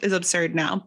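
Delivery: talking through a laugh; real laughing. Real, not acted. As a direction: laugh at your own absurd memory. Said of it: "I'm laughing because it is absurd now".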